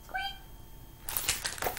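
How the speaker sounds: unvoiced, very soft, drawn-out squeak; a faint grumpy critter squeak; breathy squeak. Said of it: "He said".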